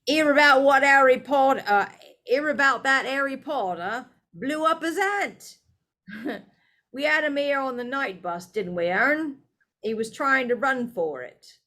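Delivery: high, loud boastful telling; lightly voiced conductor bragging to passengers; bragging gossip. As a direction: crow about it to passengers. Stan imagined bragging.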